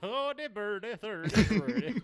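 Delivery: high-pitched, sing-song fake Swedish; hammed-up Swedish trying to sound Russian; mock Swedish accent. Host joking back about his botched Russian, silly Swedish accent. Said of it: "It sounded like Swedish trying to do a Russian accent".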